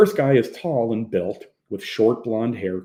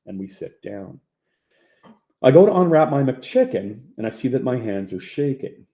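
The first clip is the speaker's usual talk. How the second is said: plain reading voice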